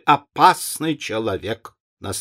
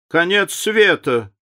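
higher, livelier echo of the phrase; picking it up; 7 semitones higher, slower than usual. A narrator's reading. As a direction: catch the phrase and top it, brightly. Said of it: "подхватила леди Нарборо". (подхватить выше и живее)

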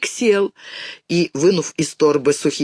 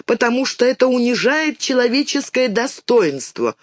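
put-on higher-pitched girl reciting mother's words; child's earnest recitation, pitched up; reciting by heart; 7 semitones higher than usual. A narrator's reading. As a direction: recite it importantly, like a learned phrase. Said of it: "наизусть повторила мамины слова".

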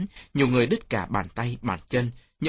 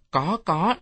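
lightly voiced child, pitched higher; child's reply; 6 semitones higher than usual. A narrator's reading. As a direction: answer as a playful kid, higher. Child answers uncle's playful question. (giọng trẻ con đáp, hơi cao)

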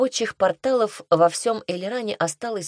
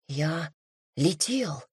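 hushed murmur; 6 dB quieter, much slower than usual. A narrator's reading. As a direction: say it softly, pausing after the first word. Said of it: "шепчет он". (тихо, почти шёпотом)

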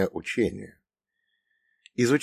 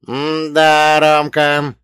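put-on loud, high, drawn-out old man's jibe; mocking old man, raised voice; sly mockery; 6 semitones higher, 9 dB louder, much slower than usual. A narrator's reading. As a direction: sneer at your pupil as the dead elder. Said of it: "усмехнулся мертвец, ехидно прищурив".

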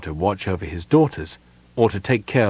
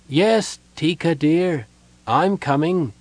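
lightly voiced, lifted call to a dear one; answering his wife; 8 semitones higher, slower than usual. A narrator's reading. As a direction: answer with a higher, tender lilt. Dying man answers his late wife.

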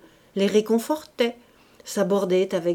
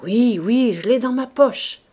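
loud, higher, flustered answer; shaken with emotion; 4 semitones higher, 7 dB louder than usual. A narrator's reading. The president answering his wife during the fire, loud and flustered. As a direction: blurt it out, rattled and overwhelmed. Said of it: "chancelant d'émotion".

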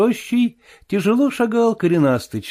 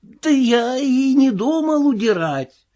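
higher, slow tearful plea; almost crying; 11 semitones higher, slower than usual. Acted. Marvel pleading with the invisible voice, higher tearful whine. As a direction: whine it out on the edge of tears. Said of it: "чуть не плача".

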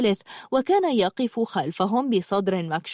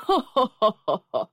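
staccato mocking laughter; scornful guffaw. Laughing at the visitors' request, scornful guffaw. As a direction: laugh contemptuously at them. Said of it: "قهقه البرق".

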